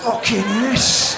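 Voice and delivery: long drawn-out cry of triumph; mock thrilled yell. Lover thrilled at breaking lockdown rules, slow mock-ecstatic yell. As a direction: stretch it out in hammed-up ecstasy.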